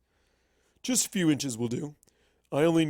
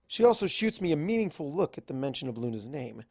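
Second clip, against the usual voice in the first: usual speaking voice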